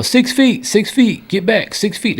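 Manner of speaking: high-pitched repeated calling out; mock safety yell. Warehouse safety guy calling to workers, high mock yell. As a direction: call it out like a bored safety marshal. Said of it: "Safety is where you just stand there, yell".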